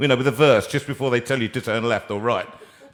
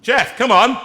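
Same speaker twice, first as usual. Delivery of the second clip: calling out; 9 semitones higher, 8 dB louder, slower than usual. Real, not acted. Calling guest on phone line.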